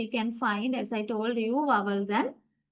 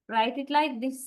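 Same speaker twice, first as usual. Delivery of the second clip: ordinary talk, nothing marked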